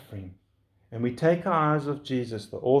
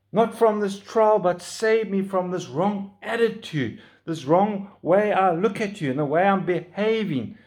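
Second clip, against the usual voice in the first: crying out to Jesus; 6 semitones higher, 5 dB louder than usual. Shown as a demonstration. Preacher models crying out to Jesus in trial: loud pleading prayer. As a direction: beg the Lord for help from the heart. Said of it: "cry out to Jesus".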